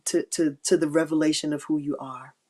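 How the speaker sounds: usual speaking voice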